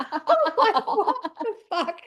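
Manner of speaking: high, laughing "what the fuck"; laughing disbelief. Retold or imitated. Told of a same-day spot, laughing disbelief. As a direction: laugh it out in shock.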